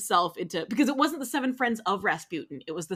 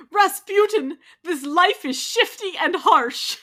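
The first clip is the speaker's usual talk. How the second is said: raised, slow, pleading voice; high, loud and slow; 9 semitones higher, 7 dB louder, slower than usual. Acted. Character line, high, loud.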